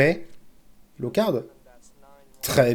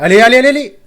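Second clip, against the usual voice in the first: loud, higher, repeated urging; urgent cheering; 6 semitones higher, 9 dB louder, much faster than usual. Real, not acted. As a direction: egg the last spin on excitedly. Urging the last spin on. (encouragement pressant et fort)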